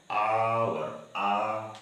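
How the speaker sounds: "आवर" stretched very slowly, then its "आ" alone; slow "आवर, आ", with no h at all; opening vowel demonstrated. Shown as a demonstration. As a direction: draw out "आवर" and isolate the long "आ". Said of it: "किसका उच्चारण हो रहा है?".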